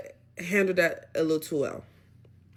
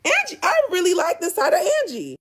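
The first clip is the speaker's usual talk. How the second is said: much higher, louder praise; excited; 13 semitones higher, 3 dB louder than usual. Real, not acted. Recapper excitedly cheering Angie for standing up to Simba. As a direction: gush about her.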